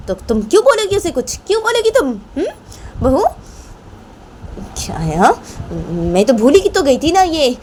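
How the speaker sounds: heated accusations, pitch leaping high; aggrieved grandmother. Acted. Grandmother threatens to tell her son everything, aggrieved. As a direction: accuse them both, then threaten to tell.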